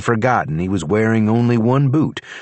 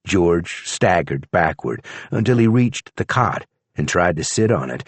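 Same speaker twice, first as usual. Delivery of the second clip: ordinary delivery, nothing marked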